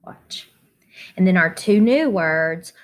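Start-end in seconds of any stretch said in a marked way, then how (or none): 0.0-0.5 whispered word. Shown as a demonstration. Teacher whispers word after spelling.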